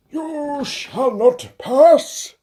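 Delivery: very high, very slow, loud wizard's command; Gandalf impression. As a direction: bar the way with a hammed-up booming decree.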